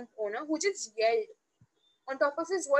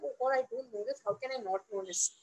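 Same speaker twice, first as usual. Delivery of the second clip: soft, lowered inner voice; deflated self-doubt; 3 semitones lower, 6 dB quieter, faster than usual. Retold or imitated. Newcomer to herself at the big firm, deflated and quiet. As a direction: shrink into quiet, retold self-reproach. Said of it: "I used to feel like".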